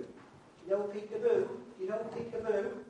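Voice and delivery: high, loud mock shout across a room; drunken shout. Drunk man shouting across the restaurant to the baby, high loud call. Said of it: "began shouting across the room".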